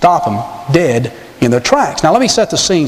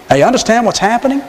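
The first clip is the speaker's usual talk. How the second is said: ordinary talk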